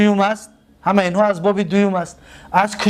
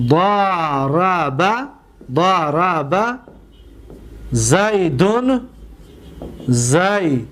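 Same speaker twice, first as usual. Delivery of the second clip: very slow, syllable by syllable; ضرب stretched, da-ra-ba; slow demonstration; 3 semitones lower, much slower than usual. Shown as a demonstration. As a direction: draw out ضرب for the class. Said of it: "دقت بتید،".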